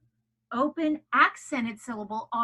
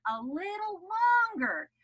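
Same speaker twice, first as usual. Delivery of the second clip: held longer; 9 semitones higher than usual. Shown as a demonstration. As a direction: stretch out "longer". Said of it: "you also hold the sound".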